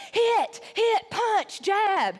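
high, loud imitation of a drilling instructor; mock yell, each command punched out high. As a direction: bark out boxing orders like a coach. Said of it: "she's, like, yelling at you".

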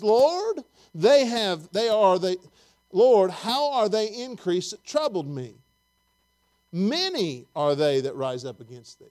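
loud, drawn-out Bible reading; emphatic scripture reading. Acted. Preacher reads the psalm to the congregation, loud emphatic scripture reading. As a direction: proclaim the verse slowly and forcefully.

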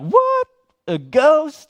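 startled disbelief. Retold or imitated. Disciples freaked out by Jesus.

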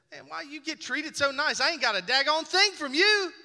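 1 indignant griping. Retold or imitated. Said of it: "and said".

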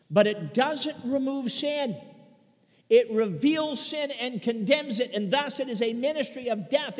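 high, driving sermon cadence, lifting at the end; emphatic preaching. Real, not acted. Preacher showing the church their need of Jesus, emphatic. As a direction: press the point home, building to a call.

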